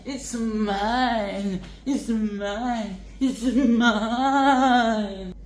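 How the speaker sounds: hammed-up possessive cry; mock greedy villain. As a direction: clutch it like a greedy thief. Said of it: "It's like".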